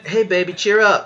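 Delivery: high-pitched acted pep-up; coaxing. Boyfriend to sulking girlfriend after argument, coaxing. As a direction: play a boyfriend trying to lift her mood.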